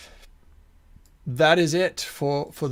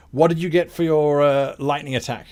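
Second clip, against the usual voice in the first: ordinary talk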